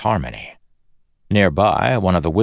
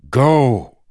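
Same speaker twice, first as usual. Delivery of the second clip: loud, higher-pitched command; sharp order; 8 semitones higher, 7 dB louder than usual. A narrator's reading. Kelsier cuts off the woman's plea, ordering. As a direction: cut in with a forceful order. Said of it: "Kelsier ordered".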